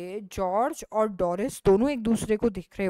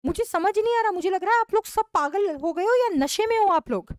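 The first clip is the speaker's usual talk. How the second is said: ordinary delivery, nothing marked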